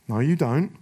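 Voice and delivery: ordinary talk, nothing marked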